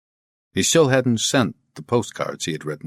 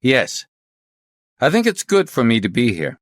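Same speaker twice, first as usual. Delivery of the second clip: higher, mildly confident reply; 6 semitones higher, 4 dB louder than usual. Acted. Inspector telling his chief he should stay, confident. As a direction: answer with a touch of assurance. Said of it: "then replied confidently".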